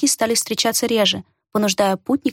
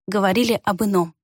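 ordinary delivery

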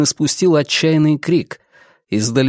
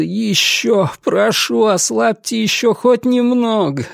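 pleading; 9 semitones higher than usual. Acted. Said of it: "Ему так хотелось взмолиться".

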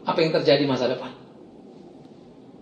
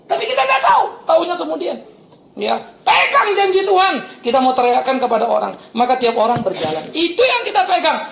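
high-pitched, forceful pulpit delivery; impassioned preaching; 8 semitones higher, 3 dB louder than usual. Real, not acted. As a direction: drive the conviction home to the congregation. (khotbah berapi-api)

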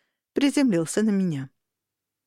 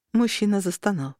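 ordinary delivery

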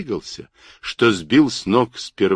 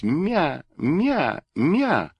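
very high, repeated little mews; mewing cat; 8 semitones higher, much slower than usual. A narrator's reading. Jumping toy cat cries out, high mewing. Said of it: "начала прыгать и кричать".

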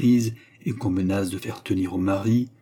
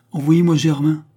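calling for a servant; 6 semitones higher, 7 dB louder than usual. Acted. Count summons his valet.

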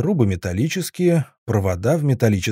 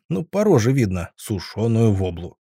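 ordinary delivery, nothing marked

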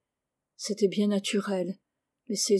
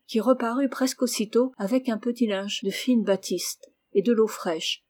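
ordinary delivery, nothing marked

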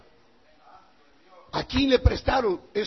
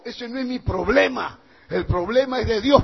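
ordinary talk

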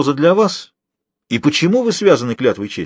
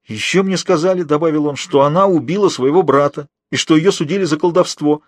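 higher-pitched, anxious informer; agitated prisoner; 5 semitones higher than usual. Acted. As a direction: report the rumour in a high anxious voice. (высокий голос встревоженного узника)

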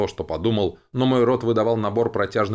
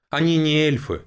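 blurted out angrily, higher and sharp; angry outburst; 6 semitones higher, 3 dB louder than usual. A narrator's reading. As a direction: snap it out in anger. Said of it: "со злостью выпалил мужчина".

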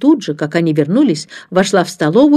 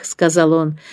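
usual speaking voice

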